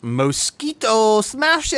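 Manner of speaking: put-on high, drawn-out Spanish-accented announcement; mock Mexican accent. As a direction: stretch the name in a hammed-up Spanish accent. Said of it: "why is he Mexican".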